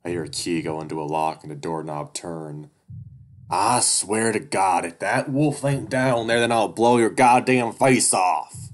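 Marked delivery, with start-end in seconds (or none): 3.5-8.7 furious threat. Acted. Duke threatens the bear.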